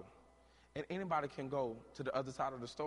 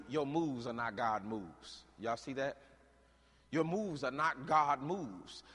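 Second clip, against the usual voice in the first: normal talking voice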